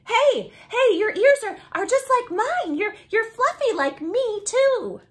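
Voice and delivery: high, loud, bouncy eager voice; excited fluffy dog. Acted. Third dog skids up to Bam and Jam, loud and high. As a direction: blurt it out, bursting to play.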